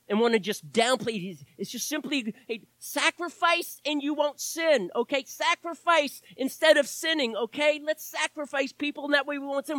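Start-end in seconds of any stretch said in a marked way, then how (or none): 2.5-9.8 high-pitched dismissive imitation; mock pep talk. Preacher parodying those who downplay the cross, mocking. Mocking rival theology in quote.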